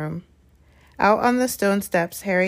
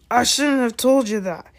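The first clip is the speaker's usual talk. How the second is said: louder, higher sudden blurt; 5 semitones higher, 4 dB louder than usual. A narrator's reading. Hagrid realizes he said too much, blurting. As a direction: let it slip out in alarm. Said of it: "he blurted out".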